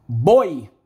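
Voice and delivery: "Boi" said loud and clipped in one beat; one-syllable demo; sharp single syllable. Shown as a demonstration. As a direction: pop "Boi" out as one piece. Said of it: "tem uma sílaba só".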